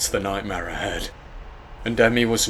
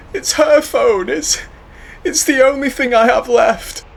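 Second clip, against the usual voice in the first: grieving; 8 dB louder than usual. Acted. Grieving boy clings to phone.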